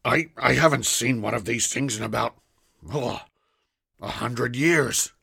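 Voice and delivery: a hint of an amazed old man; lightly voiced old man. A narrator's reading. Ash's character voice.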